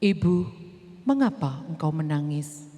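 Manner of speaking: deep gentle angel voice. Acted. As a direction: speak as a calm heavenly stranger. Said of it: "ada yang bilang kayak gini".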